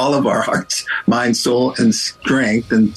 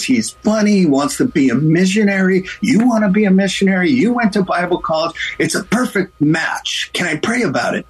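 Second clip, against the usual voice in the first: high, eager, quick pitch to a friend; excited matchmaking; 7 semitones higher than usual. Retold or imitated. Sister-in-law urging Barb toward the missionary, high excited voice. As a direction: sell the match with bright insistence. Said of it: "she goes".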